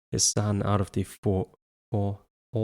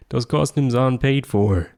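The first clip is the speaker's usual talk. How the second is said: loud, higher furious yell; angry yell; 4 semitones higher, 6 dB louder than usual. A narrator's reading. Father yelling at his son over the costumes. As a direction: bark it across the room. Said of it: "Edwin yelled".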